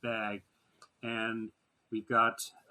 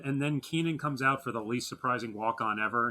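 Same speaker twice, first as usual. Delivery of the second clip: ordinary talk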